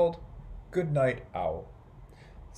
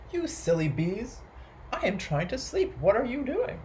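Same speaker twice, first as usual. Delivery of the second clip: lightly voiced owl, pitch climbing into a question; touch of storybook exasperation; mildly exasperated owl; 6 semitones higher than usual. A narrator's reading. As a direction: scold with rising indignation. Owl scolds the noisy bees.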